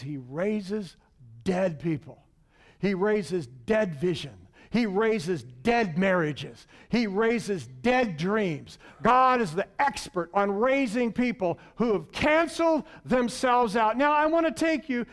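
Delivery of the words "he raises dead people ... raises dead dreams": slow, weighty list. Real, not acted. Slow emphatic sermon build.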